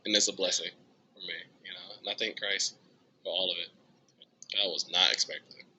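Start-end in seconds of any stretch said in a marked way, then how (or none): none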